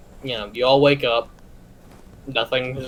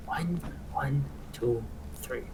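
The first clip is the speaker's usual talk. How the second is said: counting under his breath; 10 dB quieter, much slower than usual. Acted. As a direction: count quietly to yourself. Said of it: "You hear him counting under his breath".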